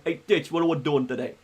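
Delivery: heavier greaser-style New York accent. Shown as a demonstration. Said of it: "there's the more deeper one".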